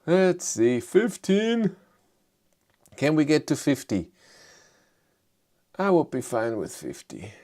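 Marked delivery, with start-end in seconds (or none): none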